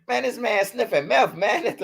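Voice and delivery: high voice breaking into a laugh; laughing disbelief. Retold or imitated. Dad pointing out the meth scene, high and laughing. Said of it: "I'm like".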